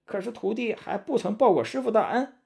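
high-pitched monkey disciple voice; Sun Wukong pleading. Acted. Sun Wukong bargaining with his master before leaving, high pleading voice. Character voice in narrated story.

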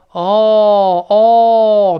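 "哦" loud and held, like a lone l; dark l as 哦. Shown as a demonstration. Teacher likening English l to Chinese, long loud "哦". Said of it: "特别类似我们中国人说".

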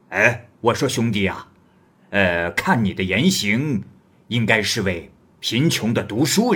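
bold wandering hero. Acted. Guest presses Li Jing about his woman, loud and swaggering. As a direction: press the young scholar like a blunt big brother.

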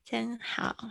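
ordinary delivery

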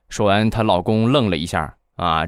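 ordinary talk, nothing marked

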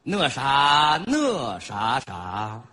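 slow, drawn-out dramatic recital; hammed-up poem recitation tone. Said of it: "主要听这个朗诵腔".

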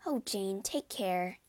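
ordinary delivery, nothing marked

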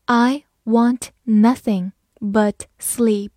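very slow, word by word; slow reading. Shown as a demonstration. Teacher models the example for learners, slow and separated. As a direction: read slowly, pausing after each word. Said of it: "慢慢来读".

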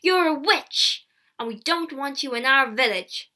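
loud accusing shout, easing at the end; crying out. A narrator's reading. Villagers banishing the accused girl, crying out. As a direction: denounce them loudly. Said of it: "the villagers cried".

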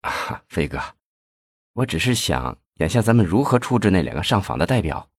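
a mild worried hush, asking the boss; worried question. Acted. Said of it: "忧心忡忡地问". (略轻的忧心忡忡地问)

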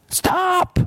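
a sudden high shout of Stop!. A narrator's reading. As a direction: cut them off with one sharp high cry. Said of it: "she shouted".

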